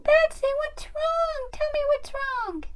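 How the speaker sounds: very high, pleading toy voice; concerned doll. Pretend. Doll asks crying friend what's wrong, high concern. Peach comforts crying Batsy.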